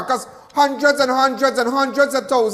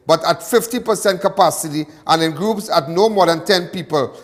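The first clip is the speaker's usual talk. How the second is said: firm, louder newsreader delivery; news read; 2 semitones lower, 3 dB louder than usual. Acted. Reading the covid measures to his viewers, firm news read. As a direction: read it out like a bulletin.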